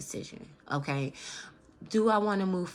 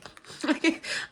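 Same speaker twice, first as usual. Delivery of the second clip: emotional laugh; 10 semitones higher than usual. Real, not acted. Emotional laugh between words.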